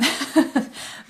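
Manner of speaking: short embarrassed laugh at herself. Real, not acted. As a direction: laugh it off, caught out. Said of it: "I sound like an infomercial. This is hilarious".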